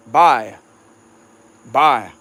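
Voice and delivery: "bye" for bayou, clipped to one syllable; "bye" as Cajuns say bayou. Shown as a demonstration. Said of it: "how some people say "bayou"? They say".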